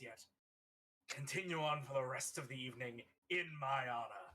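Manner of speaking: grand in-character farewell speech; theatrical toast to the crowd; address to crowd. Acted. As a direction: play the orator taking leave of revellers. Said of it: "Elvin turns to the crowd".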